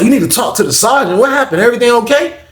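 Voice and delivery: high, put-on lady guard voice. CO lady asks the inmate what he needs, high. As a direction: play the CO asking from the desk, pitched high.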